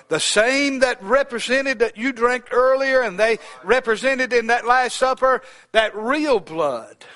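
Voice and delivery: high, loud, slow preacher's cry; preaching at full force. Acted. Preacher reminding the congregation of the supper, loud and slow. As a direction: stretch each word out to the church.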